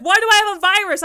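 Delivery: high, fast, loud mom question; upset mom. Retold or imitated. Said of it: "She's like".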